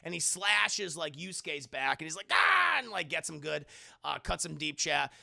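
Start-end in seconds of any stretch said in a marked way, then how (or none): 2.3-2.8 high loud battle cry; attack yell. Retold or imitated. Goblin boy slashing at the heroes, loud yell. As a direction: lunge in with a yell.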